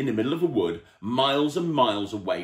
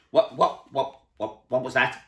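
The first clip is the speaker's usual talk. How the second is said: startled stammer; 5 semitones higher, 4 dB louder than usual. A narrator's reading. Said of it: "said Mister Chilli".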